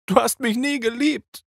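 blonde crying out. A narrator's reading. Blonde thrown against the wall, crying out. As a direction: fling the accusation at him. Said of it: "rief sie".

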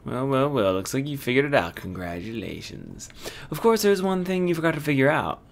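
mock-congratulating villain, rising to a high goodbye; gloating game villain. Villain revealing a parting gift to the detective, gloating. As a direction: play the smug culprit, drawing out congratulations.

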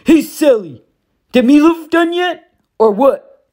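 a lazy bully barking at his wife; gruff Gabe. A narrator's reading. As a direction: stepfather yelling at Sally from the poker table. Gabe voiced in narration.

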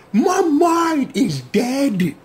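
high, slow, stunned shout; overwhelmed. Real, not acted. Viewer reeling from the reveal, slow high shout. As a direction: let it drag out in shock. Reacting to the twist.

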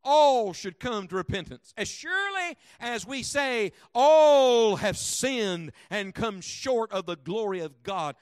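loud, slow hammering of "All"; preacher driving the word all home; preaching. Acted. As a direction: thunder the verse out to the church. Congregation answers, preacher thunders back.

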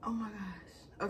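slow, soft whisper of disbelief; awed whisper. Real, not acted. Wife taking in pregnancy news, awed whisper. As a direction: whisper it, still stunned.

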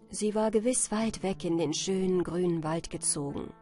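ordinary delivery

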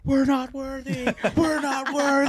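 high-pitched chant of adoration; mock worship. Co-host hailing the studio's entertainment royalty, mock worship. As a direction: grovel playfully before a star.

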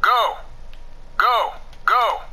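urgent call. Acted. Game character urging on.

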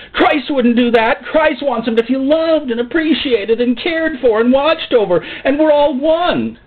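exaggerated wounded complaint; pleading objector. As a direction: protest in a hammed-up tearful plea. Voices objector pleading against the pastor.

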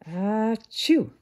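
big loud achoo; sneeze. Acted. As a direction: let out a full sneeze. Grasshopper sneezes.

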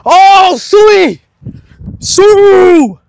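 loud, high Ronaldo-style Sui; Sui celebration shout. Real, not acted. Celebrating goal with Ronaldo cheer.

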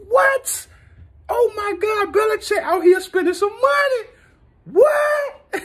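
high shouted disbelief; can't believe what you're hearing; stunned disbelief. Real, not acted. Fan shocked at Belichick's spending, high shout.

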